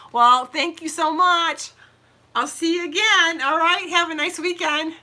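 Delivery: high, loud sing-song farewell; cheery goodbye. Real, not acted. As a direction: wave everyone off brightly. Cheerful sign-off to viewers.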